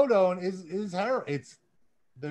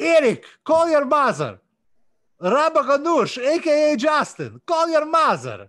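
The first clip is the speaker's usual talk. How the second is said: loud, higher hammed-up Russian accent; 5 semitones higher, 8 dB louder than usual. Reading out fan shoutouts on the podcast in a hammed-up Russian accent. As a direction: ham up a thick Russian accent. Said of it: "I'm gonna do it with a Russian accent for you".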